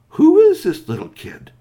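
loud, very high exclamation; high shout of disbelief; astonished. Acted. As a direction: blurt it out in wide-eyed amazement. Two friends astonished, eyes wide.

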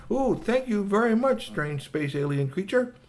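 high, polite thanks, easing down; a hint of a grateful alien; lightly voiced translator device. Communicabobulator thanks Shui for lunch, lightly voiced, high. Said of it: "And his communicabobulator said".

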